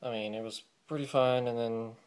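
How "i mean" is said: ordinary delivery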